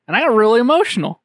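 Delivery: emotional. Real, not acted. Retelling being moved.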